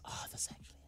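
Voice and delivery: hushed whisper; whispered realisation. Real, not acted. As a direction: whisper it as it dawns on you. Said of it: "realising mid-sentence".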